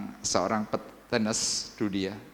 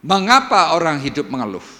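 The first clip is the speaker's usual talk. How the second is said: loud scripture cry; 6 semitones higher, 10 dB louder than usual. Acted. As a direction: call out the verse, high and loud. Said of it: "Tuhan berkata dalam ayat". (seruan ayat dengan lantang)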